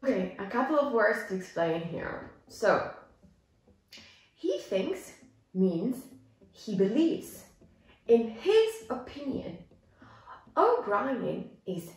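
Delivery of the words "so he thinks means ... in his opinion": normal talking voice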